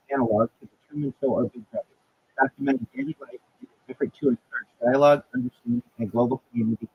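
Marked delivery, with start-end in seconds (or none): none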